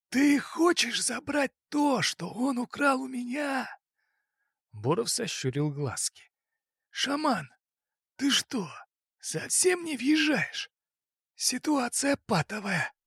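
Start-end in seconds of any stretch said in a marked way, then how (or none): 0.1-3.7 high, slow suspicious boss; crime boss voice. Acted. 6.9-12.9 high, slow exasperated boss. Acted.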